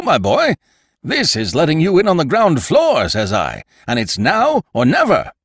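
narrator's booming salesman major; Major, stock promoter. Acted. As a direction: sell it hard like a boastful major. Major Halkett voiced by narrator.

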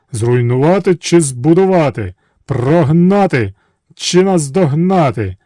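drawn-out chant awaiting orders; slow booming genies; genie chorus. Acted.

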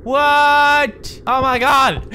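loud, high startled cry; high-pitched jump-scare outburst; startled yell. Real, not acted. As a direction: yell out in sudden shock. Startled by the horror game.

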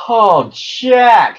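high, loud cry of joy; happy exclamation. A narrator's reading. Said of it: "she said happily".

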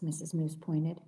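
ordinary delivery

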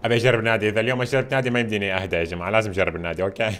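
ordinary delivery

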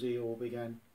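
ordinary delivery, nothing marked

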